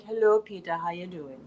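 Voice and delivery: sultry greeting, sinking low and soft; sexy aunt voice. Retold or imitated. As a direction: imitate an aunt's sexy voice, dropping away softly. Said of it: "She has the world's sexiest voice".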